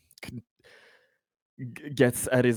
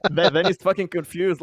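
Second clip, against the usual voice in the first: ordinary delivery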